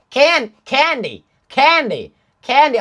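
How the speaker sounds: practising "candy" the American way. Shown as a demonstration. Coach working on his American accent, saying "candy" carefully. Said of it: "I can get the candy part right".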